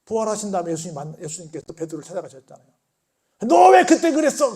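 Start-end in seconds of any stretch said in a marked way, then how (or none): 3.5-4.6 loud, high reproach; accusing question. Retold or imitated. Jesus imagined scolding Peter, loud accusing question. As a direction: demand an answer for the betrayal. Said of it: "그랬어요? 아니요".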